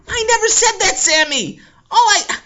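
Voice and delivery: high loud denial; loud protest. Acted. Boy denying to Sammy, loud. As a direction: yell it back.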